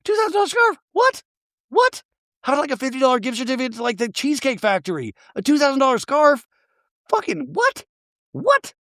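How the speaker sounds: incredulous squeal, far above normal pitch; high-pitched, indignant ranting; pissed-off disbelief. Real, not acted. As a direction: shoot up high in comic outrage. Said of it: "That pissed me off".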